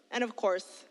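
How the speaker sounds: ordinary talk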